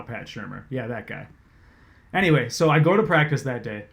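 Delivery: usual speaking voice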